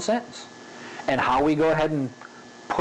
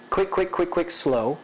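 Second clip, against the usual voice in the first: changing the pace; 2 semitones higher than usual. Shown as a demonstration. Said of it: "that's changing the pace".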